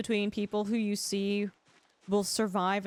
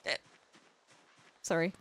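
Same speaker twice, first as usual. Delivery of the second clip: hiccuping between words; talking through hiccups; 5 semitones lower, faster than usual. Real, not acted. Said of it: "Got the hiccups".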